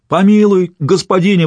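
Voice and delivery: high-pitched cry for mercy; wailed plea. A narrator's reading. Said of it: "возопил мужик". (вопль о пощаде)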